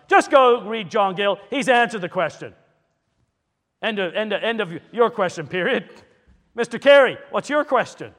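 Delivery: overbearing. Retold or imitated. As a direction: shut the matter down and call on the next man. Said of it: "could be at times very overbearing".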